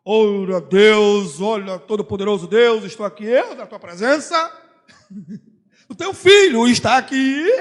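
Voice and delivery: loud, pompous, hammed-up prayer voice; theatrical prayer. Preacher mocks a showy churchgoer praying, in a loud pompous voice. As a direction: ham it up like a showy prayer, half laughing. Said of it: "Ou então chega e faz um teatro".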